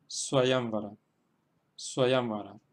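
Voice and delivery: "Swayamvara" accented on YAM; careful demonstration of "Swayamvara", YAM stressed. Shown as a demonstration. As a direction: model "Swayamvara", holding the long YAM. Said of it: "en la sílaba ya".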